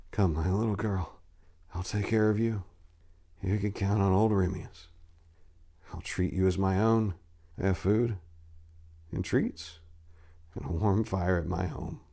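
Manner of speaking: put-on soft, tender old man comforting; gentle reassurance. Remius comforts Lilia softly as they leave, tender and quiet. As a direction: soothe the little girl softly. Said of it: "he said".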